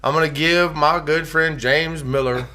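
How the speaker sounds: ordinary delivery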